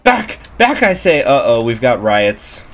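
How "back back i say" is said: high, loud order to fall back; commanding shout. Acted. Guard voiced holding back crowd.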